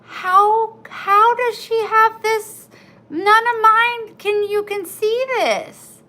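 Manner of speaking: high, loud astonished questioning; loud and high, stunned that hers came out better; amazed disbelief. Retold or imitated. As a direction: sound floored by how good hers looks. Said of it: "But I'm like".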